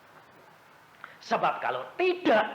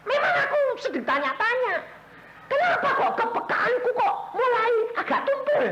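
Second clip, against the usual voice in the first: much higher, fast, laughing complaint; plaintive question; faster than usual. Retold or imitated. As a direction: whine to God, half amused. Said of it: "saya bilang". (mengeluh dengan suara tinggi sambil tertawa)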